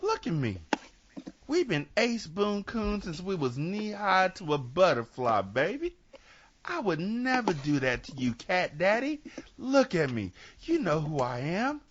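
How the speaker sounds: high start, easing into coaxing jive; seventies jive smooth-talker. Acted. Sugar Man swears innocence to cat daddy, seventies jive. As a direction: sweet-talk like a seventies movie hustler. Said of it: "sounds like something from the seventies".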